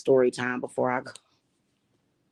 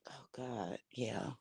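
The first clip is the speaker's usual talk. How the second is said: breathless whisper, overcome; barely voiced, remembering the pain; 21 dB quieter, slower than usual. Real, not acted. Recalling her daughter in hospital, a broken whisper. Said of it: "recalling daughter's accident, overcome".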